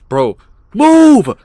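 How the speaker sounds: high, loud panicked scream. Real, not acted. Panicked yell at the game.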